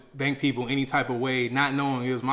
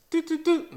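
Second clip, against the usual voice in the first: mimicking a card reader's beep, the last jumping high; imitated beeps. Mimicking the reader's beeps at the gas station pump. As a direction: doot doot doot like a card machine. Card machine beeping at him.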